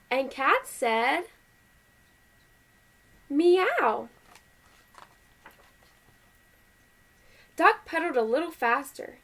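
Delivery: lively, measured picture-book reading; animated read-aloud with well-marked phrases; story read to children. A narrator's reading. Parent reading Duck on a Bike to kids, animated story voice.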